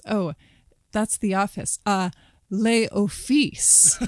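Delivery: mock French pronunciation; airy faux-French "les offices"; posh French. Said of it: "Sarah Jessica Parker goes".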